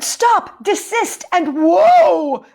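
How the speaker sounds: high, loud acted shout; storyteller's loud mock shout. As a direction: yell at the creature to stop. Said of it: "I shouted".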